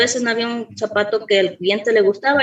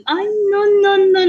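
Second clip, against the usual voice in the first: high, whimpering no no no; retold scared whine, pitched up; scared refusal; 11 semitones higher, 3 dB louder than usual. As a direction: squeal a frightened refusal, half crying. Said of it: "porque le tengo miedo".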